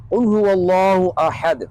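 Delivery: very slow tajweed recitation; Quran recitation. Shown as a demonstration. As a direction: recite with long, drawn-out vowels. Reciting Quran verse as example. (تجوید کے ساتھ ٹھہری ہوئی تلاوت)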